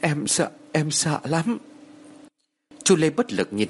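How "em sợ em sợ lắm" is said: slow, high, tearful whimper; understated narrated sob, pitched up; mild sobbing fear. A narrator's reading. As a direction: drag it out, scared and tearful. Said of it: "nức nở".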